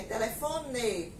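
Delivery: slow Paraná-style "telefone" with final "ê"; Paraná accent, "telefone" never ending in "ee". Retold or imitated. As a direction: imitate Paraná, keeping the last E of "telefone" closed. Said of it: "pronunciam a palavra com som de E".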